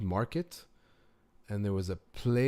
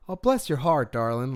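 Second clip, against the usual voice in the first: mock Southern sweetness; 2 semitones higher, 5 dB louder than usual. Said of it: "someone says, "Bless your heart," it's actually an insult".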